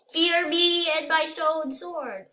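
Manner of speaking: high, loud, slow boast; grand threat. Pretend. Player warns opponents about the stone sword, grand threat. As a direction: proclaim it slowly like a mighty conqueror.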